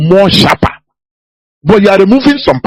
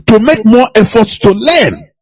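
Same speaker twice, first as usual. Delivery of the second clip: ordinary talk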